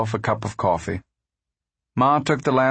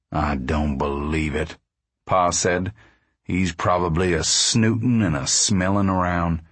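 low, doubting man's voice; disbelieving father; 5 semitones lower than usual. A narrator's reading. Pa doubting the manager's motives, disbelieving. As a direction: grumble suspicion in a low pitch.

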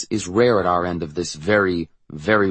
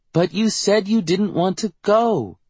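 put-on high, drawn-out little-girl protest; high-pitched child's complaint; 12 semitones higher, 3 dB louder than usual. A narrator's reading. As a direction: voice a kid objecting, pitched way up. Child repeats her protest.

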